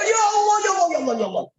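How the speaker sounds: half-joking exasperation; exasperated cry. Real, not acted. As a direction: cry out to God in mock despair. Preacher cries out in exasperation.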